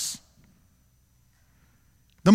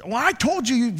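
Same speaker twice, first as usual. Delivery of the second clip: retold rough quarrel; 5 semitones higher than usual. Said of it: "they're like".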